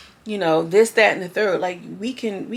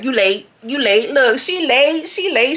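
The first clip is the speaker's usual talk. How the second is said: pitched-up singsong mockery; sassy Ray imitation; 4 semitones higher, 4 dB louder, slower than usual. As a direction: tease him for leaving. Ray mocking Bobby leaving.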